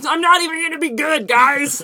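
high, loud little crab voice. Acted. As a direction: squeak it out like a defeated crab. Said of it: "Excellent crab voice".

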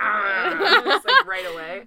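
high, laughing delight. Retold or imitated. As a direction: squeal it through a laugh. Imitating bliss of eating lettuce.

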